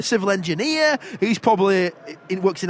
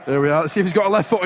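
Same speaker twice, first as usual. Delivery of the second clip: ordinary talk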